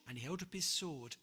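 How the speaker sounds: usual speaking voice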